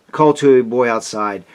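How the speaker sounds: plain reading voice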